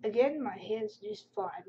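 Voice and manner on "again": usual speaking voice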